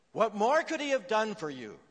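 loud, high, furious shout at him; angry shout. Retold or imitated. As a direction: shout it like a mother wagging her finger. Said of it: "and shouted".

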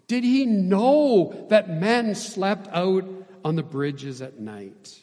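high, loud, drawn-out question; fiery challenge. A narrator's reading. Preacher restates Booth's question to his son, fiery challenge. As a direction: stretch and punch the question. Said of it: "this fiery response".

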